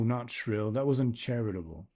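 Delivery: ordinary delivery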